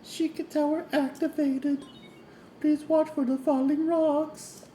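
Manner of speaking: high, sweet princess voice; a lilting fairy tale princess; Princess Zelda voice. Pretend. Reading game text in character.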